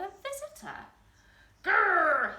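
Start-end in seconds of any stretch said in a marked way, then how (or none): 1.6-2.4 loud, high roaring growl; troll's loud bellowing growl; bellowed troll growl. A narrator's reading. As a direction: roar it at the visitor. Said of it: "he bellowed".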